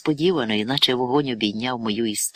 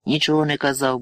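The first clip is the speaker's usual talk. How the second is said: usual speaking voice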